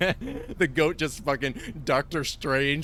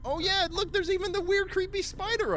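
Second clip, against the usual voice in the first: much higher, bright mock excitement; mock high squeaky voice; 3 dB louder than usual. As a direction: squeak like a giddy kid.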